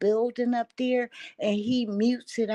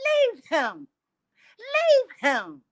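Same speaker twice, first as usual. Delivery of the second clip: insistent urging; 11 semitones higher, 4 dB louder, slower than usual. Retold or imitated. Urging her to leave.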